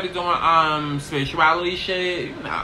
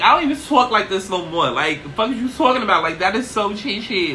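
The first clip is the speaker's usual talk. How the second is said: ordinary talk, nothing marked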